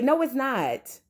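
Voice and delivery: a soft aside that rejects the praise; muttered denial. Acted. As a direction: say No, it's not softly under the breath. Rebuts the praise of the producer.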